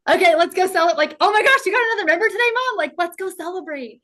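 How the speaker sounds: high, loud and fast cheering; excited kids. Retold or imitated. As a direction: gush like thrilled kids. Kids cheering mom's sales.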